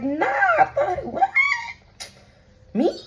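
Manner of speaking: very high-pitched, incredulous squeal; mock disbelief. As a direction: play innocent in a squeaky put-on voice. Acts out the person's feigned disbelief.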